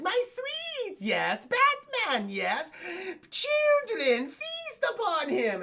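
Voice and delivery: high, slow creepy villain commands; villain falsetto. Retold or imitated. The Diddler ordering his alligators to attack, high creepy voice.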